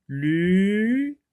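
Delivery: LU held long on a high, rising pitch; syllabe allongée. Shown as a demonstration. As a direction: stretch the vowel of LU right out. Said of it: "le mot commence par lu". (« lu » très allongé, voix qui monte)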